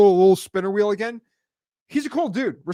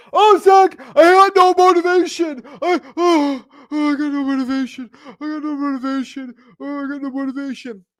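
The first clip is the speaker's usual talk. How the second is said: much higher, louder, hammed-up whiny complaining voice; whiny wholesaler; 9 semitones higher, 6 dB louder than usual. Host mimics wholesalers moaning to him, high mock whine. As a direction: whimper it like a self-pitying quitter, fading out on each repeat. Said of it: "They, they say".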